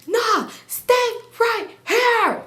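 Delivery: high, slow, drawn-out imitation yell; hammed-up high holler; mock yelling. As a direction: imitate him yelling, word by word. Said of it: "And he yelling".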